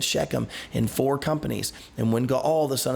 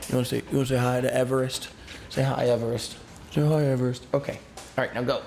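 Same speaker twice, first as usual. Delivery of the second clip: talking to the dog; faster than usual. Real, not acted.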